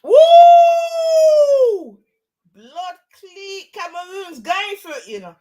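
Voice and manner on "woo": high loud whoop; whooping cheer. Real, not acted. Reacting to a big punch, loud whoop. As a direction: let out a big ringside whoop.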